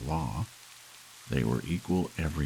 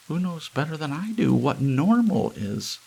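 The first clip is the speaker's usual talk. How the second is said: much higher and louder, proud wife; high boastful Hazel; Hazel's voice; 13 semitones higher, 5 dB louder than usual. A narrator's reading. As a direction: crow it triumphantly.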